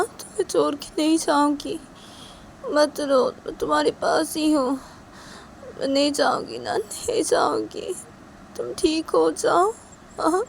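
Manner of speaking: high, coaxing, soothing voice; tender reassuring mother. Acted. Rui comforting the sick crying child, tender reassurance.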